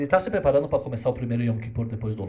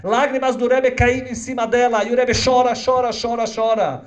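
higher, louder impassioned narration; preaching at full force; 10 semitones higher, 8 dB louder than usual. Acted. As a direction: preach the scene with rising emotion. Narração em pregação intensa. (pregação intensa, aguda e alta)

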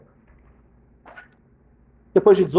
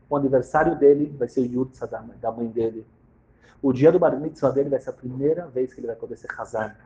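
ordinary talk

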